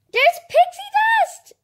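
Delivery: very high, loud thrilled cry; excited shriek. Real, not acted. Child spotting pixie dust, shrieking with joy. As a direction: shriek it with delight.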